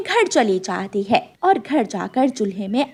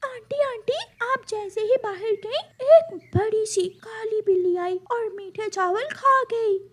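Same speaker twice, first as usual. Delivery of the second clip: put-on sly child bird; 9 semitones higher than usual. Chicks fooling the crow, sweet fake innocence.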